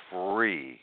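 normal talking voice

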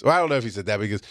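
usual speaking voice

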